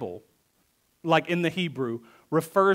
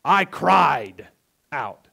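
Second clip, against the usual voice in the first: "cried" pushed louder and a little strained; stress on cried; 6 dB louder, much slower than usual. Retold or imitated. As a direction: lean hard on "cried". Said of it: "He's saying, No, really".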